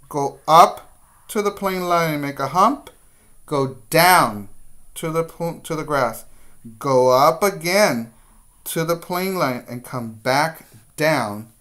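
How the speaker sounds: guiding a class through letter shapes, firmly; step-by-step teacher voice. Shown as a demonstration. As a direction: call each stroke out to young kids. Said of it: "teacher models letter strokes".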